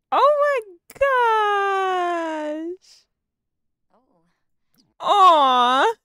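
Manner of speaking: high, loud, drawn-out wail of delight; overcome, near tears. Real, not acted. Watching her feed him chocolates, tearfully gushing. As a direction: wail it slowly, as if about to cry. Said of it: "I'm about to cry".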